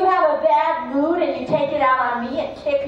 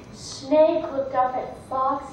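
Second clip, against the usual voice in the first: usual speaking voice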